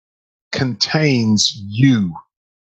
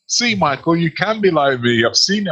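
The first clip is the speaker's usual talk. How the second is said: higher, proud boast, laughing at the end; playfully smug, pitched up, breaking into a laugh; mock triumphant boast; 4 semitones higher than usual. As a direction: crow it like a proud pupil. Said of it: "And I thought".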